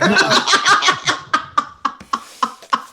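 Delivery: high, long staccato cackle; big laugh. Real, not acted. Game master cracks up at a player's joke, helpless laughing. Reacts to joke about asking weight.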